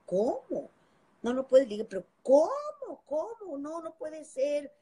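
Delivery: rising, stunned protest, slowing down at the end; can't believe what she's hearing; shocked disbelief. Retold or imitated. Singer told she can't go to the party, in shocked disbelief.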